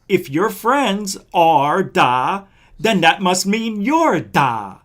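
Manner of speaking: slow, deliberate, word bleeped out as da; slow and careful. Real, not acted. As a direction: spell out the logic slowly, censoring himself. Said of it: "I didn't mean to say the whole word".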